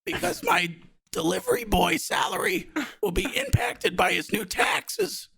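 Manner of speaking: high put-on smoker voice, slowing at the end; Steve impression. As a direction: keep the raspy smoker act going.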